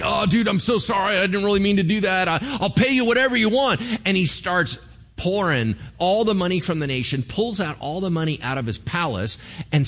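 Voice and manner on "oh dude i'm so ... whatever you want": flustered, rushed and higher, tripping over words; a king stammering apologies to the invading bully; panicked stammering. Retold or imitated. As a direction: beg the bully in a panic, fast. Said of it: "King Hezekiah starts stammering".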